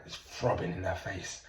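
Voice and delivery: ordinary talk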